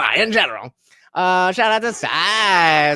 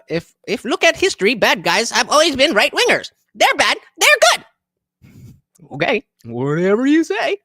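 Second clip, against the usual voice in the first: ordinary talk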